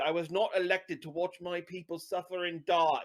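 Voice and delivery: put-on English accent slipping into Cockney; accidental Cockney accent. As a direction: imitate a film character, landing in Cockney by mistake. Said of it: "That was Cockney".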